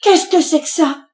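high, loud indignant outburst; wrathful cry. A narrator's reading. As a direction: cry out in outrage at the sight. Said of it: "elle cria d'un ton courroucé".

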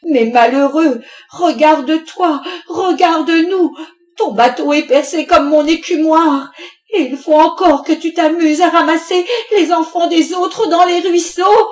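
very loud, high-pitched harangue; exasperated wife at full volume; furious outburst. A narrator's reading. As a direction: tear into your husband, voice climbing. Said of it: "la mère Louveau éclata".

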